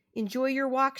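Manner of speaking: a hint of the young girl; young girl's send-off. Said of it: "she said as I left".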